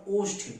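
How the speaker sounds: ओष्ठ with a clear retroflex ष्ठ cluster; ओष्ठ spoken as a careful pure form; careful tatsam form. Shown as a demonstration. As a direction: say ओष्ठ crisply, sounding the ष्ठ. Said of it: "ओठ का क्या हो जाएगा".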